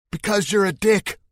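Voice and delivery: anonymous heckler shouting from the crowd; heckler's shout. Acted. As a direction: jeer it out at the man on stage. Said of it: "someone shouts".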